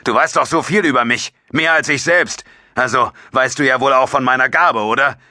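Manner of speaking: high, agitated accusation; heated challenge. A narrator's reading. Jabo keeps challenging after his outburst.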